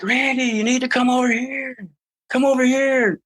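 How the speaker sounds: high, far-off call across the field; calling out to a friend from a distance; calling from afar. Retold or imitated. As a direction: yell for him to come over.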